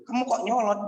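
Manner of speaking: lightly acted irritation, voice lifted; imitated angry supervisor. Supervisor scolding a student who argues back, mock angry. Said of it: "dosen pembimbingan itu marah".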